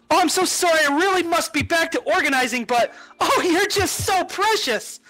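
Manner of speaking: high sweet apology; high doting farewell; apologetic organizer. Acted. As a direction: beg off sweetly, slowing at the end. GM voices coordinator apologizing.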